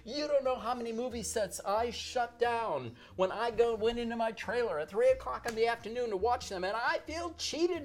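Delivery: high mock complaint of a cheated star; hammed-up indignant diva; imitating Elizabeth Taylor. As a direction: whine like a wronged movie star. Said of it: "she's screaming".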